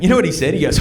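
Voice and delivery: normal talking voice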